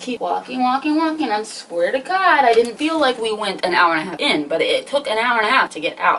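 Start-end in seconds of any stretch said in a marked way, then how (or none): none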